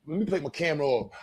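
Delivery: normal talking voice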